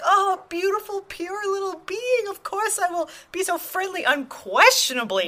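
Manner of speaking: put-on high, gushing coo over a child; adoring a child. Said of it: "we see children and we're like".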